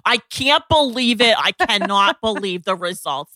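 put-on loud, high New York accent; gushing in a thick New York accent. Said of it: "this amazing New York accent".

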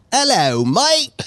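high, hammed-up Australian "Hello, mate"; cartoonish Australian accent; mock Australian accent. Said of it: "And I was doing an Australian accent".

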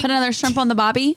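jokey put-on Australian; mock Australian accent. Riffing on co-host's failed accent, mock Australian. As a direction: ham up an Australian catchphrase. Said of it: "That was Australian".

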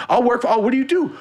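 very high, loud eager mock voice; imitating eager guy. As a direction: squeak it out like a desperate guy. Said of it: "He goes".

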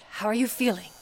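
normal talking voice

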